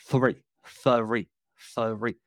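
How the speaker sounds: "three" with a laboured TH, almost "thuh-ree"; practising the TH. Retold or imitated. Host retelling how he practised alone, "three" with over-careful TH. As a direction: sound out three slowly, each TH separate. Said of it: "pronouncing the T H".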